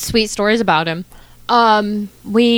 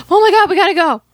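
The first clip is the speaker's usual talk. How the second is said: high, loud thrilled rush; excited gasp; 10 semitones higher, 4 dB louder, faster than usual. Retold or imitated. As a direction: burst out with excitement. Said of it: "and I was like, gasps".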